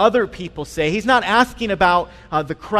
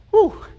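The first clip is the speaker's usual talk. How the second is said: relieved whew; 6 dB louder than usual. Retold or imitated. Relieved after dodging son's question.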